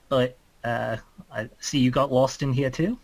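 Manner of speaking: normal talking voice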